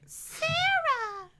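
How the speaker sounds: very high, drawn-out call; hammed-up high-pitched cry for someone. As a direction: holler the name in a mock squeal. Acting out calling for Sarah.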